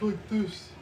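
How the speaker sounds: lightly put-on, drawn-out voice; a hint of mocking impersonation; imitating a coworker's voice. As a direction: mimic how she talks, slowly. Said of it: "Like, her voice was like".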